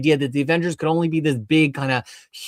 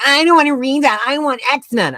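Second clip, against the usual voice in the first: put-on higher, whiny kid voice; mock whiny kid; 10 semitones higher, 5 dB louder than usual. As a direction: whine it like a reluctant kid. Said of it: "she's like".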